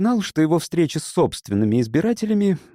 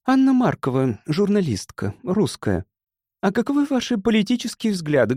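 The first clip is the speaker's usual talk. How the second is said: high-pitched woman's character voice; narrator's lifted voice for a woman journalist; Anna Markova; 8 semitones higher than usual. A narrator's reading. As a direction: introduce yourself, then question him in a raised female voice. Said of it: "спросила темноволосая женщина".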